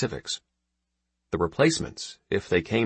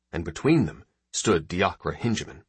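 ordinary delivery

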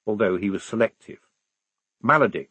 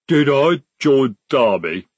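rumbling troll; 3 semitones higher, 7 dB louder, slower than usual. A narrator's reading. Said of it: "he rumbled".

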